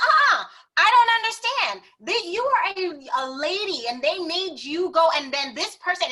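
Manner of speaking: loud, high, outraged protest; indignant partner. Retold or imitated. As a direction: play him fuming at the clerk. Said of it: "and he was livid".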